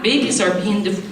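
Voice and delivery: normal talking voice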